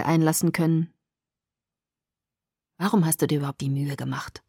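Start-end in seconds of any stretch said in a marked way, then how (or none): 2.8-4.4 low, hushed, quick cold question; frosty. A narrator's reading. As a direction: ask it icily under your breath. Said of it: "fragte ich mit frostiger Stimme". (frostig, leise und tief)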